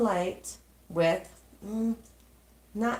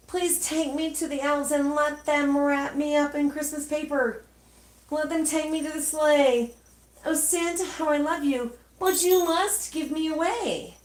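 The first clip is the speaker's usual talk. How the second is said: 1 eager plea; 7 semitones higher, 4 dB louder than usual. A narrator's reading. Peef's wished plea to Santa.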